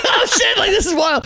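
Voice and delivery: can't hold the laughter in; laughing disbelief. Retold or imitated. Cracking up with the super.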